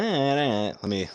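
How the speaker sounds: normal talking voice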